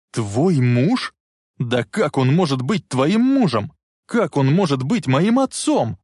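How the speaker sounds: indignant disbelief. A narrator's reading. Marty refusing Biff as a father, indignant disbelief. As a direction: build in outrage to the last question. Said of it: "возмущённо воскликнул Марти".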